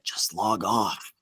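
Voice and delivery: slow, soft imitation of a boss; pained boss. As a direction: lightly act the boss giving up, drawn out. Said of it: "my boss was sitting next to me and he was like".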